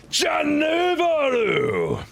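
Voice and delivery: drawn-out high announcer shout; stretched-out hype shout for a gifted sub. Real, not acted. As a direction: call the name like a ring announcer.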